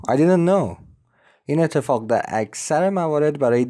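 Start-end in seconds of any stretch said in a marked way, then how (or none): 0.1-0.8 "I didn't know" run together, higher pitch; quick "diden-no" for "didn't know"; linked words. Shown as a demonstration. («didn't know» پیوسته، با صدای زیرتر)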